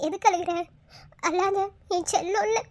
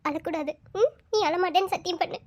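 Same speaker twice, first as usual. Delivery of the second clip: normal talking voice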